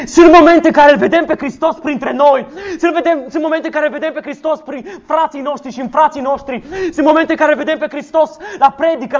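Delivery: preaching at full force. Acted. Pastor rousing the congregation on complaining, fervent preaching. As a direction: hammer each "să se plângă" at the congregation.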